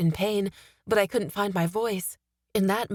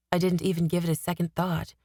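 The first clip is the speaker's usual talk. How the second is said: ordinary delivery, nothing marked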